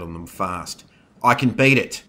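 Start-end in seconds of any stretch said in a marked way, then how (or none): 1.2-2.0 loud, raised-pitch protest; urgent, determined shout; shouted insistence. A narrator's reading. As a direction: call it out over galloping horses. Rider shouts while fleeing wolf.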